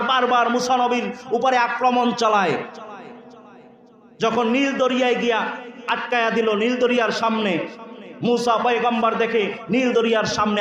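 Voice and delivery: high, ringing sermon delivery; full-force preaching. Real, not acted. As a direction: preach it hard to the whole crowd. (জোরালো ওয়াজের সুরে, উঁচু গলায়)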